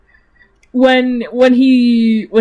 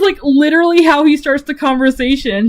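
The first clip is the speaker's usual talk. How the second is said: ordinary talk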